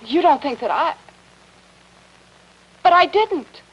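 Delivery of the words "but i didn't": high, loud frightened protest; blurting out her innocence in fear; frightened cry of denial; panicked denial. Acted. Wife protesting accusation in panic.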